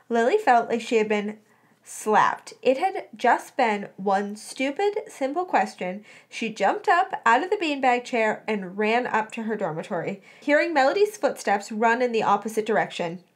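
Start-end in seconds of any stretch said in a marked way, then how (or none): none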